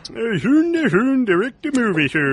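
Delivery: put-on high goofy Swedish chef accent; squeaky mock Swedish chef voice. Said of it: "The Swedish chef directing Halloween five".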